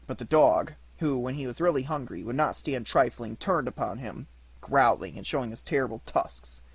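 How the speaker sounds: plain reading voice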